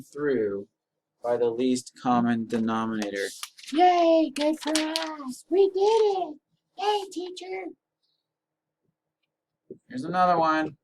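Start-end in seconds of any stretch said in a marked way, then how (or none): 3.7-7.7 put-on high squeaky kid cheering. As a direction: cheer like a tiny squeaky fan.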